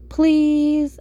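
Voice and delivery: loud, high, drawn-out plea; whiny-kid style begging; pleading. A narrator's reading. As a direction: beg with a long PLEASE. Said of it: "I pleaded".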